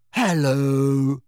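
vague, dreamy, drifting hello. A narrator's reading. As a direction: float the word out dreamily. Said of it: "a vague and dreamy voice".

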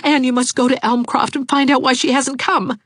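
put-on earnest young suitor pleading; Jarvis pleading. A narrator's reading. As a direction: beg her to go for you. Narrator voices Jarvis pleading.

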